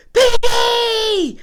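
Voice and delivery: high, drawn-out yell; full-voiced cartoon shout. A narrator's reading. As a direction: holler the name way up high, stretching it. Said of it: "he shouts out".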